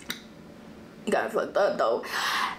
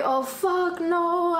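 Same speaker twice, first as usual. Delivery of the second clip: higher retold disbelief; retold outrage; 7 semitones higher, much slower than usual. Reliving her reaction to the drama, with higher mock outrage. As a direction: replay the shocked reaction. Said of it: "And I'm like".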